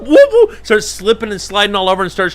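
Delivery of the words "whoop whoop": high, loud imitated bird whoops; squeaky bird call, pitched way up; pelican whoop. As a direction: mimic a pelican honking. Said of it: "the pelican starts going".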